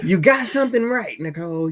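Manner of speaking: high, mock praise; teasing. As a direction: tease her playfully. Teasing Nicole.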